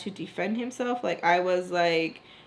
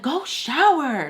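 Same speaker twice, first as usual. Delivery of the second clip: loud, high, drawn-out mock command; slow, raised bossy retelling; bossy order; 6 semitones higher, 5 dB louder, slower than usual. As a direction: imitate someone ordering her off, stretched and raised. Acted order in retold quarrel.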